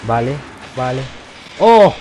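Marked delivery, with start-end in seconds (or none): 1.6-1.9 much higher and louder, sudden; surprised shout. Real, not acted. Player spotting a hidden entrance, surprised shout. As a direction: gasp out loud at the discovery.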